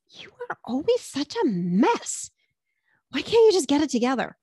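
lightly voiced mean girl scolding a friend; a hint of a mean girl sneering. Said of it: "that mean girl voice".

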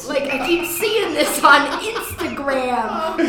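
lightly voiced cranky old prospector; drawn-out old coot bewilderment; crazy old coot. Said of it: "It's my old prospector voice".

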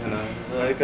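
normal talking voice

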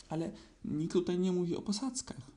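high, indignant protest; slightly offended. A narrator's reading. Owl corrects Pooh about floors, a bit offended. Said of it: "rzekła sowa trochę obrażona".

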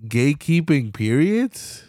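usual speaking voice

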